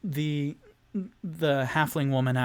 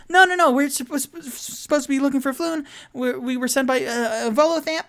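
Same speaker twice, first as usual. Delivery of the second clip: high, loud, stammering panic; Charlie Day impression; 12 semitones higher, 6 dB louder than usual. Tipsy insisting to the halfling woman, high and frantic. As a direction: babble it out, tripping on every word. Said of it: "his best Charlie Day impression".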